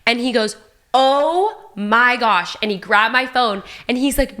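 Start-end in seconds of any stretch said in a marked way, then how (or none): 0.9-2.6 slow amazed. Retold or imitated. LeBron amazed at photo.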